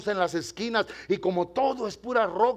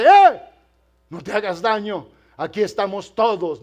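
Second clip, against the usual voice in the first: calling out; 7 dB louder than usual. Retold or imitated. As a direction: call out to stop him. Paul calls out to jailer.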